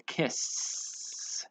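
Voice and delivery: "kiss" with a long hissed s; slow "kiss", sustained ending; S sound. Shown as a demonstration. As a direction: draw out the voiceless s in "kiss". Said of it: "has more of a S sound".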